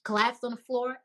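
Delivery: normal talking voice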